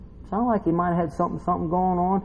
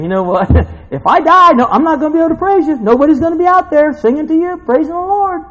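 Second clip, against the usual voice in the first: high, loud, fast protest to God; protesting David; 13 semitones higher, 8 dB louder than usual. Retold or imitated. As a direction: argue with God in hammed-up indignation. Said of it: "it's almost like David's telling God".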